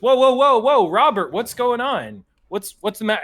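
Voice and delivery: alarmed. Acted. Asa tries to stop frantic Robert.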